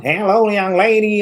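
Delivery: slow, high Oklahoma hello; Southern accent. Retold or imitated. Host mimicking Oklahoma greeting to the guest, Southern drawl. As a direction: drag out the greeting like a local. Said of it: "get used to that sound".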